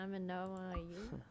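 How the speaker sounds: ordinary delivery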